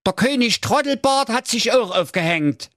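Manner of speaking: very high, silly cartoon voice; squeaky cartoon brother voice. Wilhelm voiced in fairy tale.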